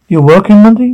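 surprised. Acted. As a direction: caught off guard, ask it high. Surprised question in a family argument.

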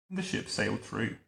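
ordinary delivery, nothing marked

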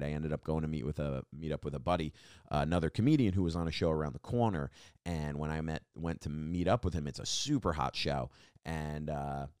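usual speaking voice

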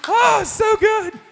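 high, slow, loud delight; joyful exclaim. Real, not acted. Speaker delighting in the young couple's news, loud and high. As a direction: savour it with a joyful shout.

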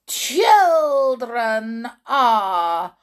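slow, loud, high witch shriek; witch's scream. Acted. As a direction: shriek it out slowly like a hateful witch. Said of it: "screamed the Grand High Mi".

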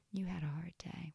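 soft, whispery inner tempter; sneaky quiet coaxing. Acted. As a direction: coax them in a hushed voice. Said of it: "It's usually very quiet".

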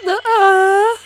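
sudden high-pitched moaning cry; mock moan, very high; imitated high moan. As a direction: yelp it out like the moan on the recording.

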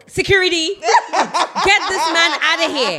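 loud, high, laughing call-out; mock call for security. Friend jokingly calling security on the Nigerian, mock-outraged shout. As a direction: play-act having him thrown out, drawing it out slowly.

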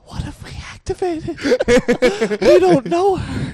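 hammed-up high voice disowning her; mock innocent friends. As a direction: play dumb like the friends who started it. Friends backing off, retold.

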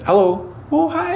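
high, chirpy mock Canadian accent greeting; hammed-up Canadian accent. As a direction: put on a bright Canadian accent, voice jumping up. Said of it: "He's like, with a Canadian accent".

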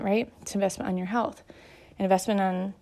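ordinary talk, nothing marked

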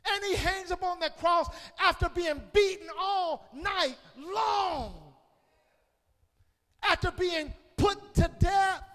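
loud, high hollering sermon; fiery climax of the sermon; preaching at full force. Acted.

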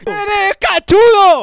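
slow, loud, high taunt; mocking shout. Real, not acted. Cohost taunting the donor, loud and slow. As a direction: drag out the insult to jeer.

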